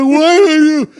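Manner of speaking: high, hammed-up wail; imitated crying. Husband imitating his crying wife, high mock wail. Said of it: "And she starts crying".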